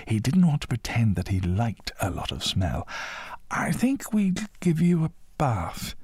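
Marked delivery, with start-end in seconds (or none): 3.5-6.0 put-on high, slow boy's voice; young boy. A narrator's reading. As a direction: suggest it high and drawn out. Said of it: "he said".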